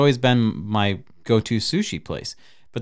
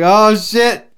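mock alarm; 10 semitones higher, 7 dB louder than usual. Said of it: "Eric was like".

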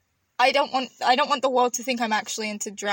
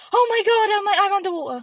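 much higher and louder, acting out a dream panic; mock dream panic; 9 semitones higher, 5 dB louder than usual. Co-host retells her drowning dream to the host, hammed-up panic. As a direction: ham up the alarm of waking underwater. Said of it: "And I'm going".

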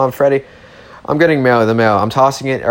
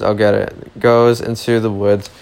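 ordinary delivery

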